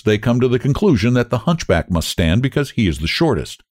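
ordinary delivery, nothing marked

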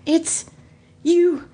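halting, high-pitched disbelief; astonished, broken-up delivery with rising pitch; sputtering disbelief. Acted. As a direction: stammer it out in pieces, stunned. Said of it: "he sputtered in disbelief".

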